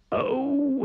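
very high, drawn-out delighted "oh"; high mischievous exclamation; gleeful "oh". A narrator's reading. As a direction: coo it high with a grin. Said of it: "he grinned".

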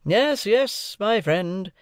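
high, insistent interruption; cried out, overriding. A narrator's reading. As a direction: cut off a protest. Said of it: "cried Mister Jaggers, waving his forefinger".

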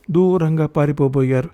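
plain reading voice

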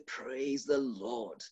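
"Praise the Lord" said low, slow and soft. Shown as a demonstration. Preacher contrasting a low, slow, soft praise. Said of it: "and I can say".

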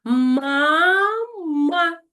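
«Мама» loud, high, syllable by syllable; syllables clapped. Shown as a demonstration. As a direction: clap out «Ма-ма». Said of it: "долоньки працюють". (по складах «Ма-ма»)

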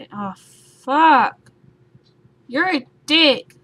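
real angry outburst mid-game; angry yell. Real, not acted. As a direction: snap at the thing that got you. Gamer yells at enemy.

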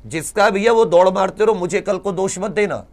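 ordinary talk

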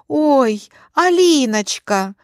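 acted happy surprise; delighted surprise. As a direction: exclaim as if greeting someone unexpected. Aunt exclaims at returning Alina.

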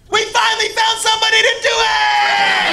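high triumphant yell; delighted shout. Retold or imitated. Shirtless guy thrilled someone did it, high shout. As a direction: crow with joy at the discovery.

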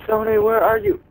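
high call across the map. Real, not acted. Gamer calls out to teammate Tony, high desperate call.

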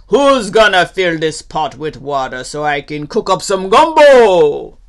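loud holler, rising to "gumbo"; calling out. Acted. Gator calls to laughing critters, loud holler. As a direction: holler it across to them. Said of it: "He calls out to them".

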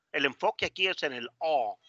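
ordinary talk

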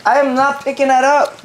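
indignant. Real, not acted. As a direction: flatly refuse with outrage. Refusing to clean wings.

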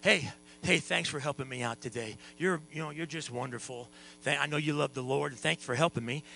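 soft, low, gentle gratitude; quiet, intimate appreciation; humble, tender thanks. Acted. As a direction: drop right down and thank her kindly. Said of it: "talking to her like this".

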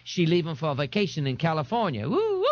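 high-pitched character voice for Jones; lilting high voice with crowing woos; Jones, sardonic. Acted. Jones passing on news about Harlow O'Hara, sardonic.

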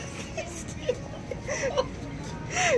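high wheezing laugh; laughing till it hurts; crying laughter. Real, not acted. Laughing hard at joke.

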